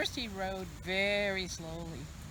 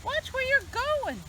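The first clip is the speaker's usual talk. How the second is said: chicken's thought. Acted. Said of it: "what she thought was".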